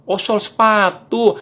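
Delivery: dawning realisation. Acted. Acted dawning realisation to vendor.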